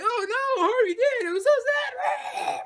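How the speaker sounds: high, fake-sad mourner voice; mock mourning. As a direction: wail it like an insincere mourner. Crowd mourning Harvey Dent, mocked.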